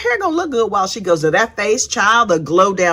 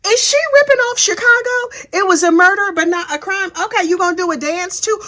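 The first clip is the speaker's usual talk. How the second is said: incredulous inner voice; 9 semitones higher than usual. Retold or imitated. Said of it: "And I was like".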